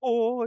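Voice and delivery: high, drawn-out ooh; Randy. Acted. Character lost in his spooky VR game, high oh. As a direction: let out a high ghostly oh. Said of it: "Randy is still in that dialogue".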